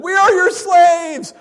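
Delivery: slow, loud, high wail; mock-grovel at the top of the voice; hammed-up groveling. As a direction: overplay the begging. Brothers grovel, read loud.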